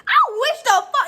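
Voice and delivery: 2 high and loud burst of anger; 3 explode at the screen; 1 angry outburst. Real, not acted. 4 vlogger fuming at the thief, loud high outburst.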